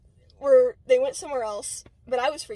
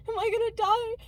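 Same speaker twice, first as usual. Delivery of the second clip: put-on higher, a touch of childish fear; scared question; 9 semitones higher than usual. Frightened child asks her parents, mildly scared and higher. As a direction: ask it like a frightened kid.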